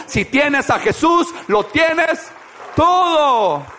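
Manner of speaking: high, loud preaching cry; preaching shout. Real, not acted. Preacher proclaiming to the congregation, shouting. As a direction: lift it to a full shout.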